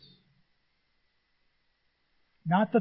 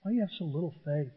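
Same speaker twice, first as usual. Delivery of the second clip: soft calm Jesus; 6 dB quieter, much faster than usual. Retold or imitated. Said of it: "still laying on the pillow".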